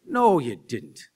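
slow mock Irish lilt. Chatting with an Irish relative, slow mock Irish lilt. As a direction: drag it out like an Irish aunt. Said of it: "pick up the cadence".

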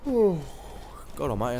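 yawning, drawn out very slowly. Real, not acted. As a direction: say it through a big yawn. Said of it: "as if I'm already yawning".

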